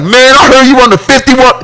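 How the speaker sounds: high, eager fan voice; fan voiced. Listener praising the host, high and eager. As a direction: gush like an excited fan. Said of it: "be like".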